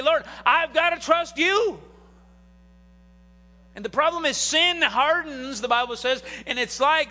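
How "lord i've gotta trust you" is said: high, loud, drawn-out cry to God; crisis plea. Acted. Believer in crisis surrendering to the Lord, pleading. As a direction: cry it out slowly and forcefully.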